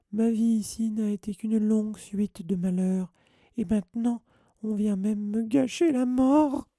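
loud, very high melodramatic wailing; lamenting. A narrator's reading. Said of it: "se lamenta Mimi". (lamentation criarde, suraiguë)

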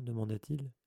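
ordinary delivery

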